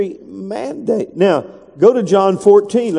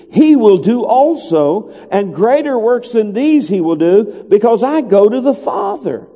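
raised, ringing scripture proclamation; emphatic scripture preaching; 4 semitones higher than usual. Real, not acted. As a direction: proclaim the promise with higher pitch and weighty pauses.

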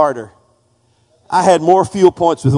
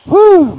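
whew; 14 semitones higher, 6 dB louder than usual. Real, not acted. Breath after a climactic sermon.

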